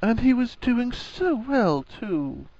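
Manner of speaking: slow, high-pitched lament; long-drawn, exasperated moan. A narrator's reading. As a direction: wail it softly in despair, drawing it out. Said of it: "Her voice moaned from under".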